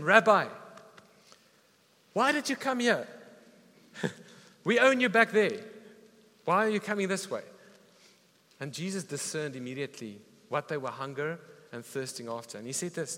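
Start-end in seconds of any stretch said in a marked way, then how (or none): 0.0-7.4 higher, louder calls of a puzzled crowd; mock crowd calling out. Preacher voicing the crowd that chased Jesus, loud puzzled calls. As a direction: call out like a baffled crowd chasing Jesus.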